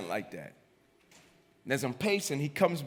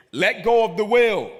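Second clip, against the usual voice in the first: loud, raised, insistent order; insistent command; 6 semitones higher, 8 dB louder than usual. Acted. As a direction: press the command home loudly. The spirit's command comes again.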